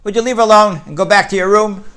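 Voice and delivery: high, loud yell from down the hall; screaming down the hall. Retold or imitated. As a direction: shout at Steve from a distance. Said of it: "she hears a voice screaming".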